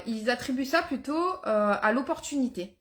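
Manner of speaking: usual speaking voice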